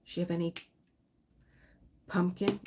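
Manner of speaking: ordinary talk, nothing marked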